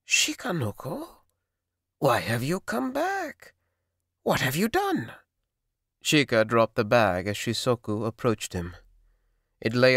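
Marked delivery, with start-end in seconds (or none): 0.0-5.1 high, strained questioning voice; old sorcerer, alarmed. A narrator's reading. Mountain sorcerer demands why the boy came back, high and alarmed. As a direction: demand answers in a thin high voice.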